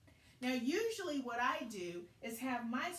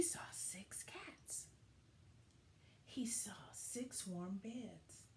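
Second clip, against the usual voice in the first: whispered, slower discovery; hushed wonder; 4 semitones lower, 10 dB quieter, slower than usual. A narrator's reading. Peeking into the house, awed whisper. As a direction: breathe it out like a secret. Dog peeks into cozy house.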